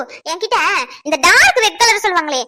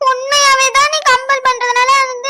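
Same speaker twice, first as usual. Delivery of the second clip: slow, high, drawn-out pleading; overacted tearful sincerity; put-on tearful whine; 4 semitones higher, slower than usual. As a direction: whine it as if about to cry. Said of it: "நீ நடிக்கிறதுல நம்பிட்டேன்னு சொல்றல".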